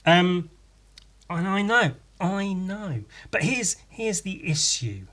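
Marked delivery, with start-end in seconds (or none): none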